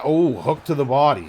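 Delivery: usual speaking voice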